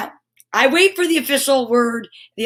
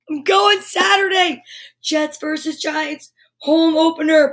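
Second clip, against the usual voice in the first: higher, near-shouted announcement; excited; 3 semitones higher than usual. Real, not acted. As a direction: blurt out the big news. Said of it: "guess what?".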